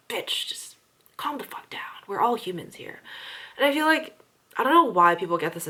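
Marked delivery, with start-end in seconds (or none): 0.1-3.0 softer, half-whispered telling-off; imagined hushed scolding of a rude customer. Retold or imitated. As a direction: hiss it under your breath. What she wanted to tell rude man.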